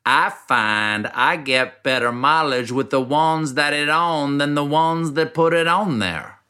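slow South Carolina drawl, word by word; slowly broken down. Shown as a demonstration. Explaining the ad line to the host, slow South Carolina drawl. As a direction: take it slowly to unpack the drawl. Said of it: "if you break it down slowly".

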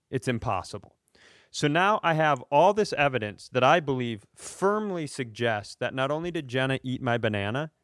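normal talking voice

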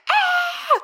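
very high pained yelp; short shriek of pain; sharp scream. Retold or imitated. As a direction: yelp as if the neck is breaking. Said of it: "And I scream".

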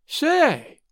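sudden high "Say" of interest; boy suddenly curious about Santa's face. A narrator's reading. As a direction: perk up all at once. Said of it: "with a show of interest".

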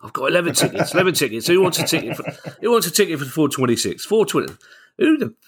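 high, hawking tout voice; ticket tout. Retold or imitated. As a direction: call out tickets like a street tout. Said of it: "You made yourself sound like a tout then".

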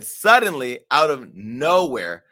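loud, drawn-out dramatic quote; milk the quote for suspense, loud and slow; dramatic reading. Retold or imitated. Host reads out the model's account, dramatic.

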